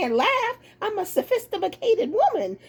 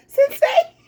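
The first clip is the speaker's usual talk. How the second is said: laughing tease; 7 semitones higher, 7 dB louder than usual. Real, not acted. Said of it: "teasing the husband".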